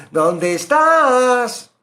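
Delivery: very slow, high, loud call of hide and seek; playful seeking call. Acted. God calling, hide and seek. (llamada lenta y alta, como jugando al escondite)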